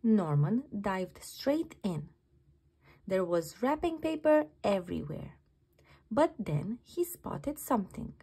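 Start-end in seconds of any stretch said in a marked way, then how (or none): none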